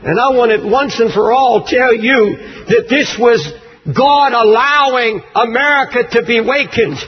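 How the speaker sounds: usual speaking voice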